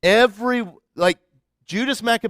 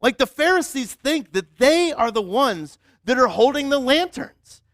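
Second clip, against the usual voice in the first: ordinary delivery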